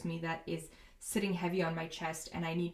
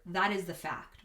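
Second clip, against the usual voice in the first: normal talking voice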